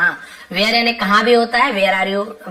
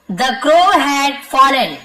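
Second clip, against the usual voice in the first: very slow, word by word, a bit louder; slow dictation; 4 semitones higher, 4 dB louder, much slower than usual. Shown as a demonstration. Teacher reading the lesson sentence to students, slow and deliberate. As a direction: read it out slowly so the class can follow.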